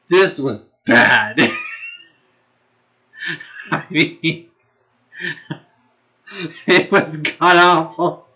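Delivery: slow, drawn-out words collapsing into laughter; laughing. Real, not acted. Vlogger judging a show awful, laughing hard. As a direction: laugh right through the verdict. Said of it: "I'm laughing because it is just".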